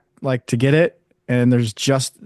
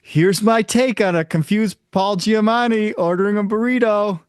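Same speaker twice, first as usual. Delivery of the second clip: announcing the impression; 7 semitones higher than usual. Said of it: "and I'm like".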